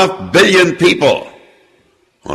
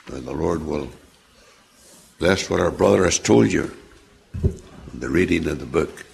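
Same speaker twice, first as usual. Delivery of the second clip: ordinary delivery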